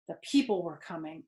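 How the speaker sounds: usual speaking voice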